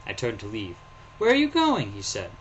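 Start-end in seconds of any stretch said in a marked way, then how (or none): 1.2-1.9 put-on high, loud childish call; a boy stopping the stranger leaving.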